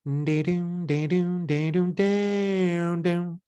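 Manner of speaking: high chanted dee-doom pattern; sung rhythm pattern. Shown as a demonstration. Partner gives a rhythm pattern back, chanted. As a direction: sing out the beat slowly. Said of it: "I'll give you a pattern back".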